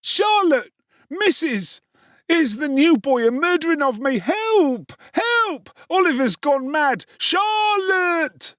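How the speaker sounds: high, loud panicked cries for help; shouting for help. A narrator's reading. Noah shouts for Charlotte and Missus to save him. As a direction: scream for rescue. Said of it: "Noah's shouts".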